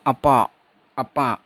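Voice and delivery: अपा ending on a long open vowel; final r dropped. Shown as a demonstration. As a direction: say अपा with no r at the end. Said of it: "तो इसको बोलेंगे". (अंत में र छोड़कर अपा)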